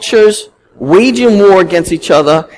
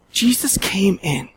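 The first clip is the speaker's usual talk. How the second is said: hushed whisper; 9 dB quieter, slower than usual. Real, not acted. Preacher to his congregation, a slow reverent whisper.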